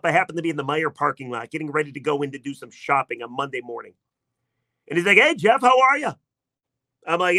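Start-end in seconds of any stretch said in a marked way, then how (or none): 5.2-6.1 high, loud, eager hello; excited greeting. Retold or imitated. Brother calls gleefully to greet Jeff. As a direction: greet him like he can't wait to gloat. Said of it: "He was very excited".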